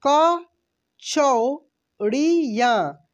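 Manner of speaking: कचौड़ियाँ stretched out very slowly, each syllable held; slow sounding out. Shown as a demonstration. Teacher breaking down कचौड़ियाँ for learners, very slow. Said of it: "क्या पढ़ेंगे".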